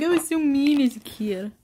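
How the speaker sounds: lightly voiced regretful figure; a touch of a confessing voice; older character. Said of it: "an older one that's just like".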